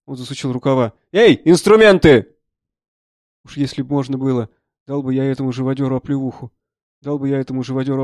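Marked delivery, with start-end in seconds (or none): 1.1-2.4 high, loud, drawn-out shout; calling out. Acted. Doctor with sleeves rolled up calling for instruments, shouting. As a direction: bellow across to an assistant.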